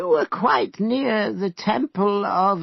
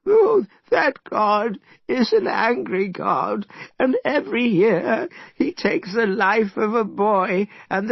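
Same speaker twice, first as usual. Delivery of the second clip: mild sorrow; 7 semitones higher than usual. A narrator's reading. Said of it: "he began to cry loudly".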